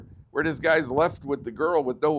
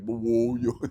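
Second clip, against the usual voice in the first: mock Brooklyn accent, punched loud; thick Brooklyn accent; 5 dB louder than usual. Imagined newscaster speaking thick Brooklyn, mock accent. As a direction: exaggerate a Brooklyn accent for a laugh. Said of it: "a Brooklyn accent, you know, thick".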